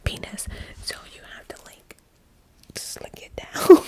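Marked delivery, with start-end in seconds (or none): 2.8-3.9 slow, cracking up mid-whisper; breaking into laughter as she says it; giggly embarrassed whisper; laughing. Real, not acted. Laughing at her own explanation.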